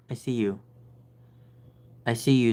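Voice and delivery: ordinary talk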